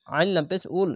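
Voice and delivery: ordinary delivery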